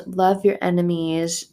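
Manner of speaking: usual speaking voice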